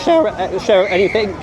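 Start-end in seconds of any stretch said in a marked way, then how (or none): 0.0-0.3 squeaky voice crack; voice squeak. Real, not acted. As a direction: voice cracking up high. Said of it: "My voice just squeaked then".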